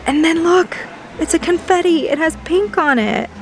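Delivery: high, excited gushing; real excitement. Real, not acted. Shopper showing viewers pink on the confetti, excited. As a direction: show off the find in a high, thrilled voice. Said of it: "I'm so excited".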